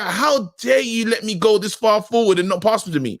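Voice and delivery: high, indignant player rant; mock fury. Player berating a teammate who didn't pass, acted fury. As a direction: act outraged, pitched well up. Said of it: "is furious. He's like".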